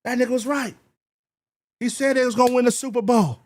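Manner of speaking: lightly voiced fans eating their words; mock fan admitting he was wrong. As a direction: play the fans conceding, hyped. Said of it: "And y'all be like".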